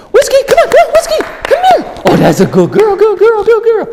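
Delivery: very high, loud, squealing puppy talk; excited high-pitched coaxing; playful excited call. Shown as a demonstration. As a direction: hype the dog up to chase you. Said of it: "so it looks like".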